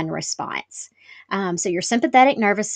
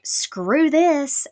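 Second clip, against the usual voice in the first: higher, mildly defiant retort; a touch of fed-up defiance; fed-up quote; 6 semitones higher, 3 dB louder than usual. Retold or imitated. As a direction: imitate a fed-up worker walking off. Coworker quitting, voiced in story.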